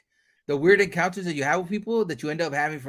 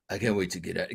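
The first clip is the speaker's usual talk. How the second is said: mock serious dork; 11 semitones lower, 7 dB quieter, faster than usual. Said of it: "he was always serious, but, like, dorky".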